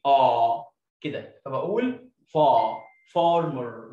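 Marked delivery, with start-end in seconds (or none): 0.1-2.8 فا said slowly with a long open ah; long ah demo. Shown as a demonstration. Teacher drawing out فا for the class slowly. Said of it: "بنطقه ايه".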